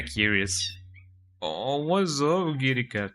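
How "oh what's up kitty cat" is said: stretched out, slow cooing to the cat. Real, not acted. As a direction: draw it out tenderly for the kitty. Streamer greets his cat.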